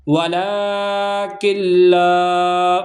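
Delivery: ولا کل لا with each la stretched long; slow recitation. Shown as a demonstration. Teacher chanting ولا کل لا slowly for the class. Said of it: "اس کو کھینچ کر پڑھیں گے".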